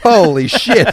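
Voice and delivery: loud, high shocked gasp of words; shocked exclamation. Retold or imitated. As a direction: blurt it out in horror. Watching ruthless hippo videos.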